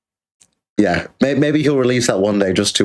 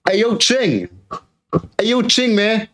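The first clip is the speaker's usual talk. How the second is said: higher call across to a neighbour; 10 semitones higher than usual. Pretend. Streamer calls out to neighbour Ching, pretend shout. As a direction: call out to the guy next door.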